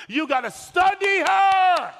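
full-force preacher's exhortation; preaching emphasis. Real, not acted. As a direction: lean hard on every word. Preacher drives point home.

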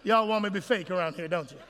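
usual speaking voice

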